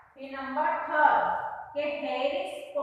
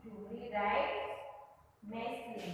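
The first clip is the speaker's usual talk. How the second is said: softer, murmured while writing; soft dictation; 5 semitones lower, 10 dB quieter, much slower than usual. Shown as a demonstration. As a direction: quietly read out as you write.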